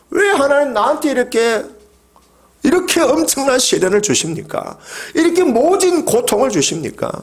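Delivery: high, pleading cry to God; an anguished believer crying out why; anguished lament. Acted. Preacher voices a lamenting believer.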